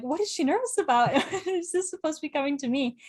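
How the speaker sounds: laughing bewilderment. Retold or imitated. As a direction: laugh through your own confused questions. Retelling her bafflement at messages.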